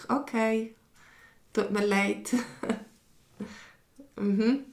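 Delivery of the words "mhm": ordinary delivery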